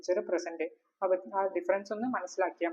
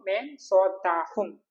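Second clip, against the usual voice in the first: slow, careful demo of മേ സോത്താ ഹും with each syllable drawn out; slow model phrase; 3 dB louder, much slower than usual. Shown as a demonstration. Teacher modelling the Hindi phrase for students, slow and deliberate. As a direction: spell out മേ സോത്താ ഹും slowly for the learner. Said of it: "ഞാൻ ഉറങ്ങുമോ?".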